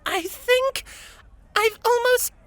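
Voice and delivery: very high squeaky unicorn voice. A narrator's reading. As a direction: squeak it out while straining. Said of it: "the unicorn squeaked".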